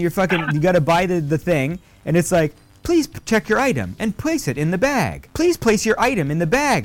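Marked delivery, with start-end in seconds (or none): 2.8-6.8 higher, lightly mimicked robotic checkout prompt; self-checkout machine voice. Griping about Walmart self-checkouts, mock machine voice. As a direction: parrot the nagging machine. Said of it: "like the stupid computer".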